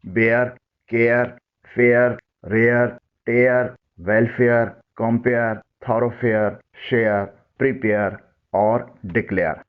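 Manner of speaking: teacher modelling the air sound in each word; careful pronunciation demo. Shown as a demonstration. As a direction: clear model words ending in air, like bear, care, share. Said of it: "ان الفاظ کی sound سنیں".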